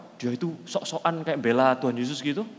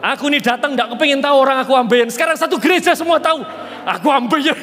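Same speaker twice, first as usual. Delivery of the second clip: high, loud complaining shout; exasperated outburst; 10 semitones higher, 11 dB louder than usual. Retold or imitated. Embarrassed man protesting the whole church now knows, loud. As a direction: vent the embarrassment loudly.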